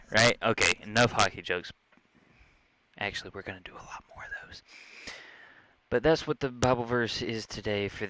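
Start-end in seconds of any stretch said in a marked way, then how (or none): 3.0-4.6 whispered aside. Real, not acted. Sly aside after hockey jokes.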